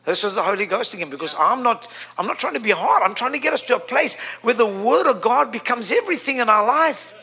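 usual speaking voice

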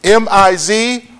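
slow letter-by-letter spelling; each letter given slowly and separately; spelled out. Shown as a demonstration. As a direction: spell it out slowly for note-takers. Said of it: "It is spelled".

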